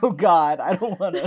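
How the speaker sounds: loud, laughing dismay; laughing mock panic. Host retelling being called out on her accent, laughing mock panic. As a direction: blurt it out through a laugh. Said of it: "and I was like".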